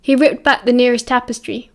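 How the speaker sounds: usual speaking voice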